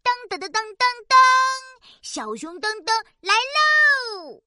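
bouncy announcer intro; sing-song show opener. Acted.

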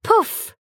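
a high bursting poof; poof spray sound. Acted. Narrator voices the trunk spraying water, high poof. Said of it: "spray sound".

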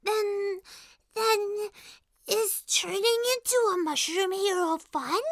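shy question. Acted. Little Mushroom asking whether being a hero is fun, shy and hesitant. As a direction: ask timidly, restarting the first word.

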